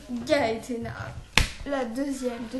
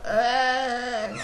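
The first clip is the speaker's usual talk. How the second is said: raspy rapper voice; 2 semitones lower, 5 dB louder, much slower than usual. Retold or imitated. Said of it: "Elle avait une voix comme ça".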